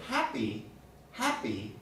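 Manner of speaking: HAPPY with no burst of air on the middle P; unaspirated P. Shown as a demonstration. Teacher showing an unstressed syllable, unaspirated P in happy. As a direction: keep the P in happy free of any puff. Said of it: "there's no burst of air".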